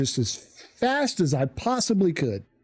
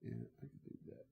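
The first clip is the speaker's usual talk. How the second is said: unvoiced aside under his breath; whisper; 5 semitones lower, 28 dB quieter, much faster than usual. Real, not acted. Streamer whispering an aside during the ad break. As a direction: whisper it to yourself.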